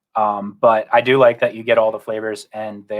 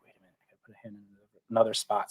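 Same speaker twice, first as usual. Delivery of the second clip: softer and fast, talking to himself; quick mutter to self; 4 semitones lower, 10 dB quieter, faster than usual. Retold or imitated. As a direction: mutter it quickly under your breath. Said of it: "and I'm like".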